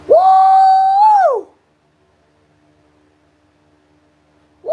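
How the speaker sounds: long, high held whoop; falsetto woo. Pretend. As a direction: hold a high woo, then let it slide down.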